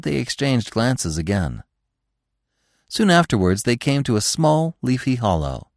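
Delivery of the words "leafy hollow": ordinary delivery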